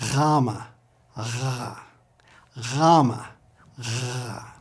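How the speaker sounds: "Gamma" as "GHAH-ma", then "R" as a soft gargled ghhh; gargled Greek gamma. Shown as a demonstration. Teacher leads repetition, gently gargled G. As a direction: alternate "GHAH-ma" with a long throaty "gh". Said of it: "like a gentle gargle".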